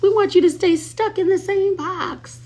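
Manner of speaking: high mocking hater voice. High mocking imitation of her haters, aimed at her viewers. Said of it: "Of course not".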